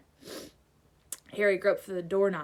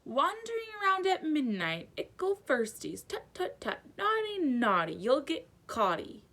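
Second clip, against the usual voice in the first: high, teasing sing-song poltergeist; taunting poltergeist; 7 semitones higher than usual. A narrator's reading. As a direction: tease like a gleeful ghost. Peeves taunts the students.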